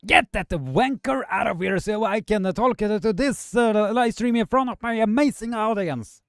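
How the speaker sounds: high, loud put-on Scottish accent; mock Scottish accent. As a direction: ham up a Scottish brawler. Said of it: "however you're saying that".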